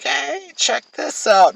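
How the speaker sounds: high, drawn-out show-off call; slow teasing excitement. Retold or imitated. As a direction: lure her in, slow and playful. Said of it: "I'm like".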